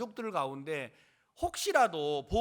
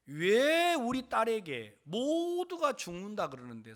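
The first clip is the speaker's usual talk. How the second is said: louder, drawn-out angry demand; cursing reproach; 4 semitones higher, 8 dB louder, slower than usual. Retold or imitated. Girl's father demands an explanation of his claim, angry reproach. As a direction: retell a relative's slow, aggrieved demand. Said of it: "욕을 하더라고요".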